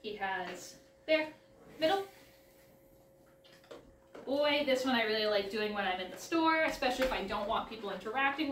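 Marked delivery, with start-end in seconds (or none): none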